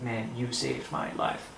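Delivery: soft, low, slow gratitude; heartfelt thanks. Retold or imitated. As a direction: say it quietly and sincerely to a rescuer. Retold heartfelt thanks to his rescuer.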